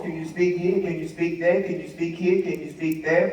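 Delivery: low, soft, repetitive phone requests; rattled-off requests. Retold or imitated. Callers asking him to speak, low and repetitive.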